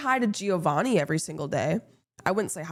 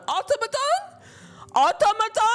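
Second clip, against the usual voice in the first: very high, questioning mock voice; high mocking parrot; squeaky imitation; 3 dB louder than usual. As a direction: mimic someone squeaking it back. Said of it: "That's not how I fucking talk".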